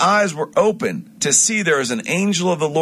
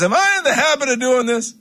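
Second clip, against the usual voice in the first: high, mildly put-on donkey voice; talking donkey; 10 semitones higher than usual. Preacher voicing Balaam's donkey asking if it ever behaved so, high mock voice. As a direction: lightly play the talking donkey, pitched up.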